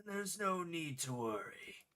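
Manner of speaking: slow, low, solemn recital of game lines; dramatic game reading. Acted. Streamer reading game text as a solemn epic. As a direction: intone it like an epic cutscene.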